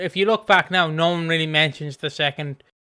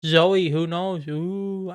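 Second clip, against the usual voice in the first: mock-mysterious teasing, higher; teasing gossip; 4 semitones higher, slower than usual. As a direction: tease like a soap trailer. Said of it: "they're doing this whole thing about".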